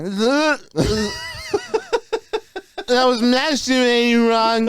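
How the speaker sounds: put-on high, slurred drunk guy voice. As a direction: sound wasted and sloppy. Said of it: "that drunk voice is pretty spot on for you".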